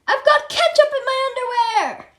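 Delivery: high, loud wailing cry; outraged wail. A narrator's reading. Principal yelling from the restroom about ketchup, high loud wail.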